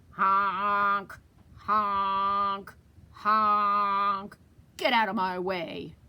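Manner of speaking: loud drawn-out honk honk; loud truck honk. Shown as a demonstration. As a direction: honk at the traffic. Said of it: "what does he say".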